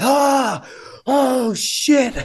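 high-pitched gasping alarm; startled awake. Acted. Delirious contestant woken after passing out, high startled gasp. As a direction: act out being jolted out of a dead sleep. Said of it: "I pass out and you wake me up".

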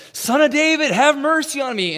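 very high, very loud desperate cry; frantic shouted plea for mercy. Retold or imitated. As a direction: scream over the crowd pushing him off. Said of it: "he starts screaming even louder".

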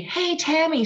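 put-on high teasing kids' call; mocking kids.

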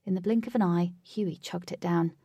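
usual speaking voice

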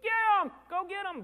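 put-on high, loud yell urging the kids on; cheering shout. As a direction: cheer them on from the sideline, full voice.

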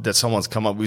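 normal talking voice

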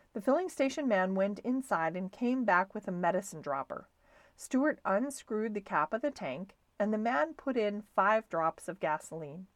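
ordinary delivery

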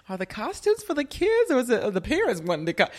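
high, loud, imitating sister through laughter; amused disbelief. Host retelling her sister's question about the costumes, amused disbelief. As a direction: crack up while retelling a pointed question.

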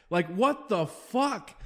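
slow, drawn-out exasperation; frustrated. Real, not acted. Host fed up with unanswered texts, frustrated. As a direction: stretch every word in disbelief. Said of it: "I'm just so fucking frustrated".

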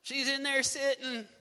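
mock huff about slaving; Martha complaining. Pastor voicing Martha's complaint, mildly indignant. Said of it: "Martha says".

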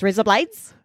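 "raise up lights" run together quickly; really fast. Shown as a demonstration. Showing the Australian accent trick, really fast. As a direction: rattle it off as mock-Australian "razor blades". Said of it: "Just really fast".

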